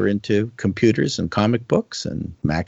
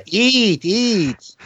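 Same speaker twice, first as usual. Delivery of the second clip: high voice of a mom urging food; high, insistent video game mother; 13 semitones higher, 4 dB louder than usual. Acted. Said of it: "mom's putting food on your plate game".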